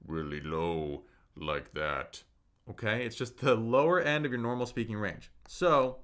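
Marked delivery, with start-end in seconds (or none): 0.1-2.2 exaggerated deep, slow low voice; very low, drawn-out pitch demo; really low. Shown as a demonstration. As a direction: drop way down to the bottom, slowly. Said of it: "You don't have to stress your body out".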